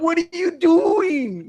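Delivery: very high, slow, loud wail; imitated horrified yell. Driver repeats his protest to passenger, horrified yell. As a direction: wail it in mock disbelief, dragging the words. Retold horrified reaction to friend.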